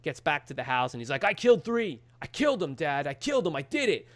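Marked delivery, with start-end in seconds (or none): 1.2-4.1 higher-pitched imitation of a boasting kid; mock triumphant announcement; proud boast. Kid telling his dad about killing three, excited imitation.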